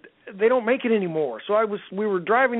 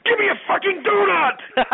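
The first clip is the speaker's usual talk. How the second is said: much higher, louder furious yell; 4 dB louder than usual. Retold or imitated. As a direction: explode at the kid over a donut. Pregnant wife snaps at daughter.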